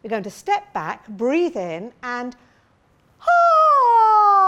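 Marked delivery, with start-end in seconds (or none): 3.2-4.5 high, loud ah as if throwing a javelin. Shown as a demonstration. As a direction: hurl the sound like a javelin. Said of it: "I'm gonna show you what I mean".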